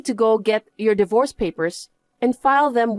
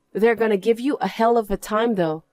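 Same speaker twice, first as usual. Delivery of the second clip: normal talking voice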